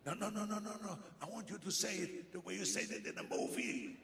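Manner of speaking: high, eager fan voice; excited fan. Retold or imitated. Fan begs him to say it like the movie. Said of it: "this guy looked at me".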